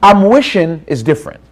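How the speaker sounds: ordinary talk, nothing marked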